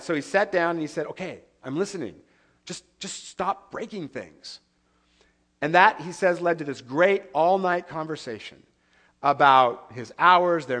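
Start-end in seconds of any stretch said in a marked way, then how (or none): 1.1-4.6 placating husband. Retold or imitated. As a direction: ease her gently to stop. Husband calming his upset wife.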